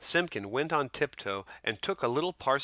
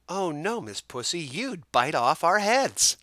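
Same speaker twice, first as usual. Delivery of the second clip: mice refusing; 7 semitones higher, 4 dB louder than usual. A narrator's reading. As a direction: squeak it out like tiny mice. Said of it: "little mice only laughed".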